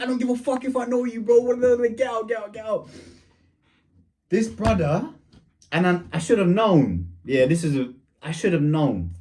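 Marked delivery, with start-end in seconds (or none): 0.0-2.9 high, rapid dismissal; mock irate father; acted angry dad. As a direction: cut off the pleading and order them out. Dad rejects plea, orders out.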